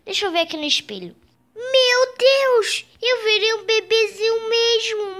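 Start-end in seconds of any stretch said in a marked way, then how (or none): 1.6-5.2 shocked cry. Acted. Sees himself in the mirror.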